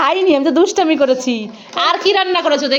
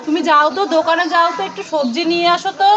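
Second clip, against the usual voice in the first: ordinary talk, nothing marked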